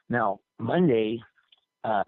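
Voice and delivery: normal talking voice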